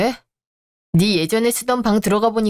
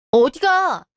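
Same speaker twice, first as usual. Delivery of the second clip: calling after her; 5 semitones higher, much slower than usual. Acted. Husband calls after wife leaving.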